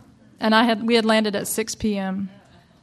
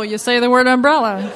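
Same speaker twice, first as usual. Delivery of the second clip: laughing repeat; 2 semitones higher, 6 dB louder than usual. Real, not acted.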